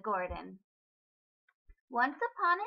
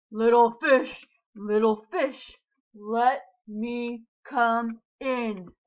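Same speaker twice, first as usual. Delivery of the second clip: loud, slow menacing shark; big bad shark; 8 dB louder, slower than usual. A narrator's reading. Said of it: "roared the shark".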